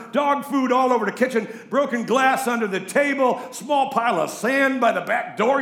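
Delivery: loud, high-pitched storytelling sermon; impassioned preaching. Acted. Preaching at full force.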